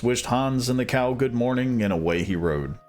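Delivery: usual speaking voice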